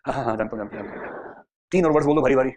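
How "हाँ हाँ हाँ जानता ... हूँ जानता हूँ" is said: hasty yeses fading into a whisper; bluffing agreement. Acted. A car buyer bluffing to the salesman, fading to a whisper. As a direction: pretend to know and trail off. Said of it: "अब वो पूछ नहीं पाएगा".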